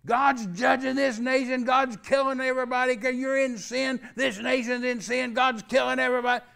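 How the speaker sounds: full-blast mock fire-and-brimstone shouting; screaming preacher. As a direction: holler judgment like a frantic pulpit screamer. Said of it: "just screaming, and, and it was horrible".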